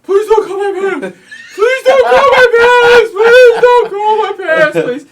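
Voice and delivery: high, loud, pleading mock wail; imitating crying buddy. To the Kmart guard, begging in a mock wail. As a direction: ham up a panicked kid begging. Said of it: "He is fucking crying".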